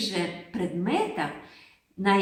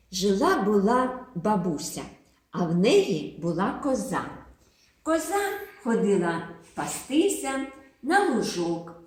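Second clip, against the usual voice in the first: ordinary talk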